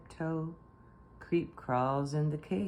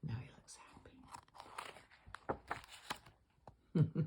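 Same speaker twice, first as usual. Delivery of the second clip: whisper; 11 dB quieter, much faster than usual. Real, not acted. Aside to child while reading.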